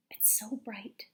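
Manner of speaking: breathy whisper, dazzled; a whispered gasp at blinding light; whispered awe. A narrator's reading. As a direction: hush it as the light blinds you. Blinding flash in the dream.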